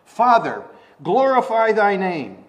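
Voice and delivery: loud, high call to the Father; ringing prayer. Acted. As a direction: proclaim it loudly, drawn out. Declaims Jesus calling to Father.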